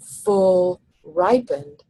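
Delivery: full and ripened slow and hard; both stressed. Shown as a demonstration. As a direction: hammer full ripened slowly. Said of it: "hard, hard".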